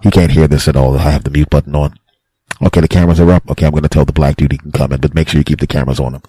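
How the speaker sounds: low, loud man on the store speaker; unguarded store announcer. Acted. Staff secretly talking about a customer, low and loud. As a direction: give low, loud orders, sure nobody can hear.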